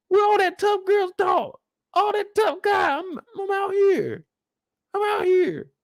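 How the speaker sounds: high, stammering mock cry voice; mocking whiny voice. Streamer mocks Clifford crying in the car, high stammering whine. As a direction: squeak it out like a crybaby mocking him. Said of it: "mocking Clifford crying".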